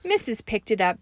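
ordinary delivery, nothing marked

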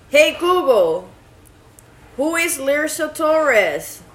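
loud, high call to the speaker. Real, not acted. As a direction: call out clearly to the device. Calling the voice assistant.

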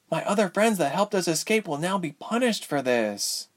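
high, distressed character voice; worried young heroine. Acted. Ilse to cellmate about endangered friends, high worried voice. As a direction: voice her guilt in a high register.

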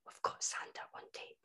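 unvoiced excited whisper. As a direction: hush it like a secret. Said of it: "my mum and dad were like".

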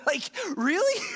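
high, laughing really?; real laughing disbelief. Real, not acted. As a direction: laugh at how absurd the question is. Laughing at Peter's question.

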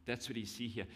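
normal talking voice

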